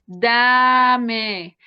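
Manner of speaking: put-on loud, drawn-out dame, dame for the child; modelled request. Shown as a demonstration. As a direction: model it slowly so he can copy.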